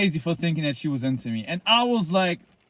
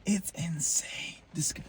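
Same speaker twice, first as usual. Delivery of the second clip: whisper; 3 semitones higher, 12 dB quieter, slower than usual. Real, not acted. Whispered aside after loud line.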